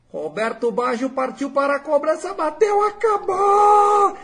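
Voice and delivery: grito de gol agudo e alongado; narração de pênalti berrada, arrastada; imitando Galvão Bueno. Retold or imitated. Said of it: "o Galvão Bueno". (narrador de TV gritando o título)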